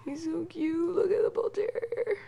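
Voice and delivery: high cooing pet voice. Real, not acted. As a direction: coo at the sleeping dog. Cooing over the dog.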